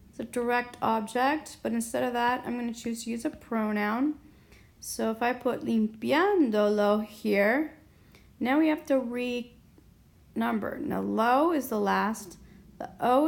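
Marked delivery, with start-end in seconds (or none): 5.7-7.1 "limpiándolo" with a punched, louder "pián"; stress on PIAN. Shown as a demonstration. Teacher adding a pronoun, "limpiándolo" stressed on the third to last syllable. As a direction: keep the stress of "limpiándolo" on PYAN, lim-PYAN-do-lo. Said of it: "we're gonna keep it there".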